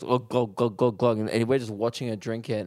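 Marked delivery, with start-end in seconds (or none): 0.0-1.2 mock gulping noise, glug after glug; imitated glugging. Comic telling of a girl sculling his mate's beer, imitated glugging. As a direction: imitate someone downing a pint. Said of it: "this girl just goes".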